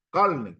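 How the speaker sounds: "carne" with the r turned into l; "carne" with an l before the n; dialect pronunciation. Shown as a demonstration. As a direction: say "carne" as "calne". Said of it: "¿El dialecto fonético qué es? Pronunciación".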